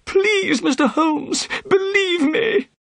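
very high, pleading wail; desperate cry. A narrator's reading. Arrested man begging Holmes for help, crying desperately. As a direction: beg to be believed, voice soaring in panic. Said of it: "Thaddeus cried".

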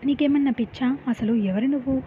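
usual speaking voice

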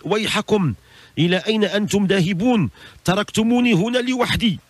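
high, pleading cry of abandonment; acted plea. Buried man to mourners leaving him alone, pleading.

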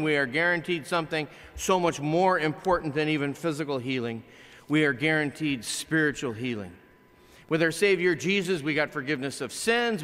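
ordinary delivery

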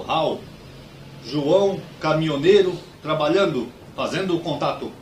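word by word, a pause after each; mock first-contact message, solemnly spaced; making alien contact. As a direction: announce yourself to an alien in spaced-out single words. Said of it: "Vou fazer contato".

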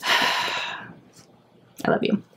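slow breathy whispered love; soft, slow thanks to a friend; tender sigh. Real, not acted. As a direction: sigh it out, deeply touched. Touched by gift, sighing.

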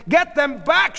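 high, loud command; sharp order. A narrator's reading. Sheila to Marvin in the crowd, shouted order. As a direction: order people back at full voice.

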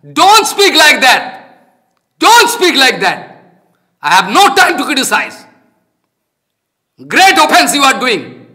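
loud, high, forceful scolding; real angry rebuke. Real, not acted. Teacher rebukes listeners who accuse him of criticizing, angry shout.

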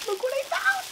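excited. Real, not acted. Showing off a found item.

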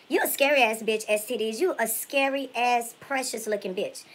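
normal talking voice

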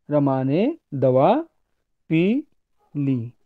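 dictation style, a clear break after दवा and after पी; pauses between words. Shown as a demonstration. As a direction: say पी and ली as separate words. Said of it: "तो मैं कुछ वाक्य कहूंगा". (शब्दों के बीच विराम)

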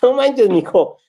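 a clerk mocking a battered husband, high and loud; mocking laugh-through retort. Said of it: "voltea y se empieza a reír".

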